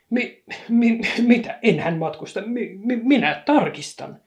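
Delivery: loud, high, stammering conductor; flustered stammer. A narrator's reading. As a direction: trip over the words in panic.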